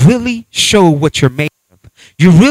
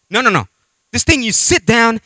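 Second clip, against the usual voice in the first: ordinary talk, nothing marked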